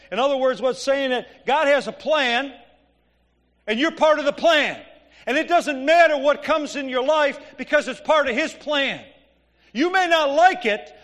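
loud, high preaching that pounds each point; preaching at full force. Acted. Pastor urging the congregation that suffering is God's plan, preaching at full force. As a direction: drive it home to the congregation, full voice.

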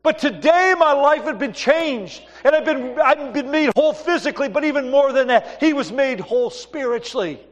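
high, loud revival preaching; preaching at full force. Acted. Pastor preaching to the church with full fervor. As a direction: preach it out to the congregation. Preaching the beggar healed story.

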